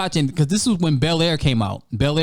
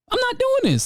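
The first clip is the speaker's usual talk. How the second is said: high exasperated outburst; high disbelieving cry. Real, not acted. Exasperated rant at ex.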